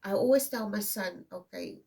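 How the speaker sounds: usual speaking voice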